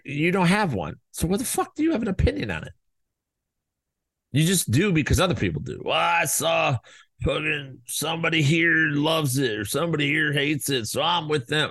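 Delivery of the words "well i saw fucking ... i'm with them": raised, shouty sheep voice; mock follower voice. Mocking people who just follow the crowd, raised and shouty.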